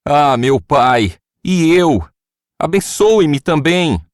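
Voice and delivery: high, loud, slow bitter plea; bitter cry. A narrator's reading. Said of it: "soltou um forte grito amargurado e suplicou". (grito amargurado e suplicante, agudo e forte)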